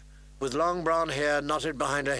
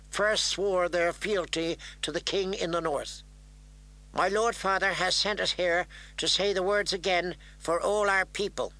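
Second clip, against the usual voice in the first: put-on formal young envoy; 4 semitones higher than usual. Kneeling girl addressing the lords of Stark, formal. Girl pledging before the dais.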